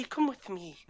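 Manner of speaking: breathy whisper, beckoning; whispered coax. Acted. Female dwarf whispering to the travelers to follow. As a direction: lean in and whisper it. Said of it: "she goes".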